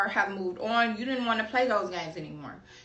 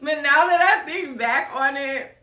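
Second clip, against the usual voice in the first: real laughter running into the words; laughing; 4 semitones higher, 7 dB louder than usual. Real, not acted. Looking back on a childhood scare, laughing. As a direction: talk through the tail of a big laugh. It's actually funny.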